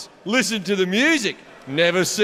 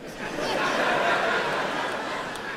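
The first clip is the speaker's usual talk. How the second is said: silent laugh; 12 semitones higher, 7 dB quieter than usual. Real, not acted. Comic laughs retelling friend onstage.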